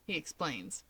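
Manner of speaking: usual speaking voice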